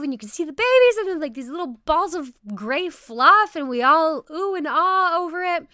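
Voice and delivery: high, loud, fast rush of excitement; excited. Retold or imitated. Teacher recalling the class seeing eagle babies, excited. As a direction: gush over the tiny hatchlings. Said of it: "then I get so excited".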